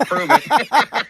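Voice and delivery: a playful dare through laughter; laughing challenge. Real, not acted.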